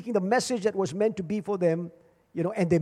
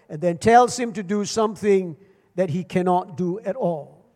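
normal talking voice

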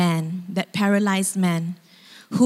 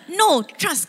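eager insistence; 8 semitones higher than usual. Retold or imitated. Abu brushes off friends' ladder question.

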